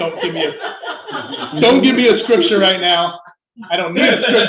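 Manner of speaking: loud, laughing, fed-up protest; exasperated mock outburst. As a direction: blurt it out like someone sick of being preached at. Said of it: "you're just like".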